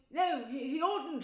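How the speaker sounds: loud, high cut-in; shouting. A narrator's reading. Mole cutting off Rat with his mouth full, shouting. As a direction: shout him down. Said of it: "shouted the Mole".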